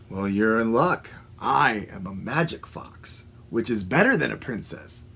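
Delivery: lightly voiced low fox; sly fox. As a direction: smoothly talk them into trusting you. Sly fox luring the frog.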